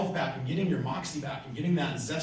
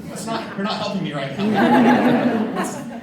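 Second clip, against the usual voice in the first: slow, laughing half-protest, much louder and higher; 6 semitones higher, 9 dB louder, much slower than usual. Retold or imitated. Protesting to the tough banker, laughing. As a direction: laugh through telling the banker he's not helping.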